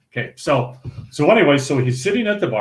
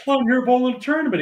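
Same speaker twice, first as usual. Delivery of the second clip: quick reply; 10 semitones higher, faster than usual. Retold or imitated. Bowler retells his answer.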